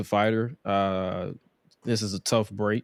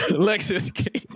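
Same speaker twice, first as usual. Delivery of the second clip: laughing through the name, high and slow; high, drawn-out laughing repeat; amused at slip; 8 semitones higher than usual. Real, not acted. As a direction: crack up at your own mistake.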